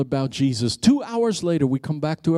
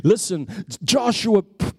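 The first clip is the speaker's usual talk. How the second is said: usual speaking voice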